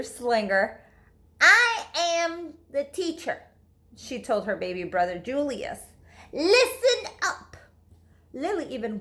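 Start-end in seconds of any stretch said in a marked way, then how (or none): 1.4-3.4 put-on a little girl's bossy teacher voice, higher and slow; pretend-teacher voice for baby brother; playing teacher. 6.3-7.6 high, slow, bossy teacher voice with a laugh; giggly pretend-teacher order; playing teacher.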